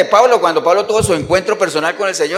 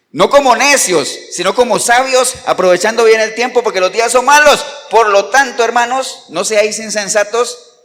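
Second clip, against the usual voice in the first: emphatic scripture reading; 4 semitones higher, 6 dB louder than usual. Acted. Preacher reading the verse to the congregation, loud and emphatic.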